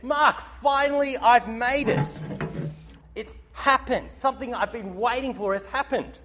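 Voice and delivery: excited friend. Retold or imitated. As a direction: gush like you've finally made it. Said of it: "bursting with energy".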